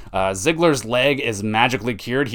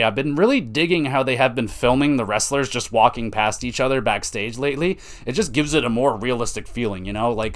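normal talking voice

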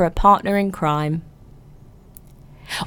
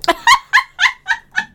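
high burst of laughter. Real, not acted. Laughing at French tuck ick.

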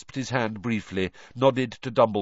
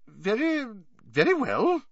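high-pitched, startled stammer; flustered woman caught off guard; 13 semitones higher than usual. Acted. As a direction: falter in surprise, voice jumping up. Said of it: "looking startled".

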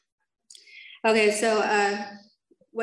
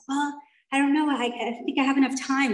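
higher-pitched, mock-reluctant hedging; retold wavering excuse, pitched up; hesitant reply; 5 semitones higher than usual. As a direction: act out your own unsure answer.

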